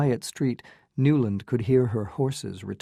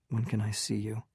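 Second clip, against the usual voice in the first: softer, hushed question; low voice; 7 dB quieter than usual. A narrator's reading. Newland asks Ellen at the door, in a low voice. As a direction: ask it quietly so no one overhears. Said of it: "he asked in a low voice".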